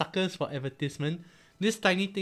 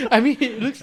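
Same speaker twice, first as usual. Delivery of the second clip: laughing; 10 semitones higher, 10 dB louder than usual. Real, not acted. Laughing at the Pokemon sticker.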